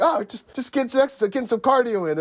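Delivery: high, light quoted voice; acted offhand excuse. Answering the onlooker in a retold scene, mock-innocent. Acting out character's casual excuse.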